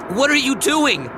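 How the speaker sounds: high, loud alarmed shout; wife shouting at husband, high and loud. A narrator's reading. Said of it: "Tammy shouted".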